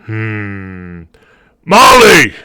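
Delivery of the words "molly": loud, higher call across the room. Acted. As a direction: shout for the barmaid in the back.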